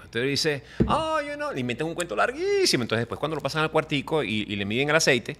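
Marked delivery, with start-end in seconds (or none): 0.9-1.5 high-pitched stretched-out "oh, you know"; smooth-talking traveler. Acted. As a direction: act out a traveler stalling, high and drawn out. Acts traveler's evasive reply to officer.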